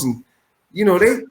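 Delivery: ordinary talk, nothing marked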